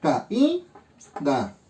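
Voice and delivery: teacher spacing out "Ca, i, da"; split into syllables. Shown as a demonstration. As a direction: lift the "i", pause, then drop low on "da". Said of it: "hacer la división silábica".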